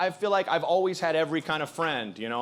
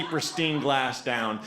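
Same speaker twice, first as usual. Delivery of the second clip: ordinary talk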